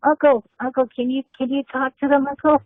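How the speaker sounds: put-on high childlike pleading voice; nephew voice. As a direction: beg your uncle in a squeaky kid voice. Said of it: "I was like".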